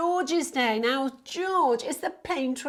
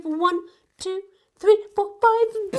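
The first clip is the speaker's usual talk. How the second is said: rising higher and louder with each number; building count; 9 semitones higher, 6 dB louder than usual. Acted. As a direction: count up to a thrilled peak.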